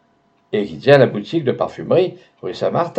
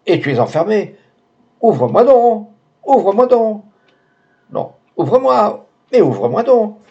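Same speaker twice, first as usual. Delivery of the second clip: high, loud urgent call through a door; 9 semitones higher, 4 dB louder than usual. A narrator's reading. The doctor outside the locked door, urgently calling in. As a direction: pound on the door with your voice. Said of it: "la voix du docteur".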